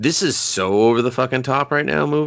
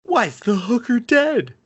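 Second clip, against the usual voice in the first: high squeaky mock of the character; 12 semitones higher than usual. Mocking the friend's line in a high squeak. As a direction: whine it like the clueless friend.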